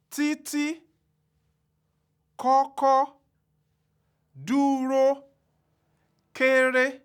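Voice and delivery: títí, kọ́kọ́, dúró, kéré each sung out on a high level tone; every syllable of títí and kọ́kọ́ held high and even; high tone words. Shown as a demonstration. Teacher demonstrating Yoruba high tone, high and level. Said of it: "The high tone can also occur".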